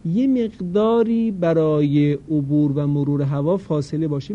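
normal talking voice

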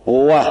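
و with lips rounded into a tight w; و said the Arabic way, lips pursed; Arabic rounded waw. Shown as a demonstration.

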